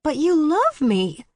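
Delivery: high, sweet little-sister pleading; innocent baby sister. A narrator's reading. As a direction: play the wounded innocent. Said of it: "sounding like the innocent baby sister".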